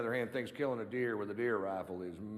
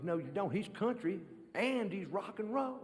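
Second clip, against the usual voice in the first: fan voice; 8 semitones higher than usual. Retold or imitated. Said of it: "He goes".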